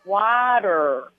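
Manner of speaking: put-on pause, then water said in a Minnesota accent, as wah-der with a strong r; water delivered after a pause in a Minnesota accent; a careful German copying a Minnesota accent. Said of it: "He would pause".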